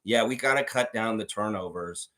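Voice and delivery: lifeless locker room talk. Retold or imitated. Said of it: "He comes in the locker room and says".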